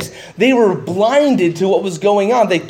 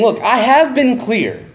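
higher, fast exasperated protest; exasperated Jesus; 6 semitones higher than usual. Acted. Jesus rebuking the crowd, higher and exasperated. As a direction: voice Jesus pleading that he was clear. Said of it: "He's saying".

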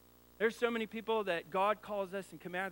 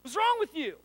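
much higher and louder scolding; mock angry parent; 13 semitones higher, 12 dB louder than usual. Father scolding his own kids, loud mock anger. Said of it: "I'll be like".

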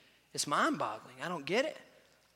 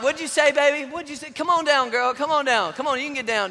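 higher, louder coaxing call; coaxing dad; 6 semitones higher, 6 dB louder than usual. Retold or imitated. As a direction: coax a scared child down. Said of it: "I'm like".

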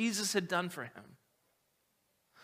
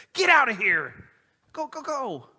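loud, higher mock wife throwing him out; mock angry wife; 6 semitones higher, 8 dB louder than usual. Wife throws out her ungrateful husband, loud and exasperated. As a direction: shoo him out the door.

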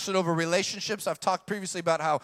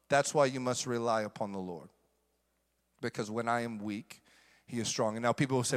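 hushed; 9 semitones lower, 6 dB quieter than usual. Real, not acted. Preacher confiding to congregation, hushed and low.

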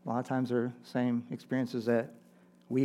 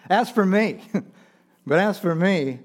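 AS FOR ME higher and louder; emphatic turn; 6 semitones higher, 7 dB louder than usual. Retold or imitated. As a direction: lift "As for me" boldly to mark the turn. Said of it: "The tone of the psalm turns here".

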